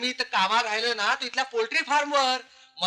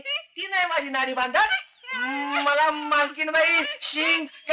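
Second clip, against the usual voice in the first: high, whiny put-on servant voice; simpleton servant; 4 semitones higher, slower than usual. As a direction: explain innocently in a high servant voice. Plays simple servant before mistress. (भोळ्या नोकराचा बनावट उंच आवाज)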